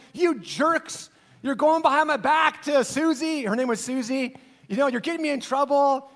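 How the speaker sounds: hammed-up annoyed father; mock scolding dad. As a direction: tease the boys like an exasperated dad. [name] mock-scolding the boys.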